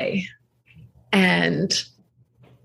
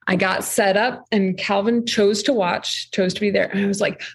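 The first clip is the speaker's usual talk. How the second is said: ordinary talk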